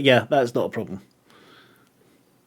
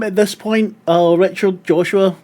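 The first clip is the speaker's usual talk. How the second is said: ordinary talk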